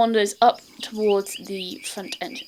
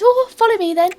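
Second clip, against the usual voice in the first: much higher, louder fussing matron; housekeeper voice; 13 semitones higher, 9 dB louder than usual. Acted. Housekeeper greeting the adventurers at the door, much higher and louder. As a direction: greet them like a bustling old housekeeper. Mary leads them.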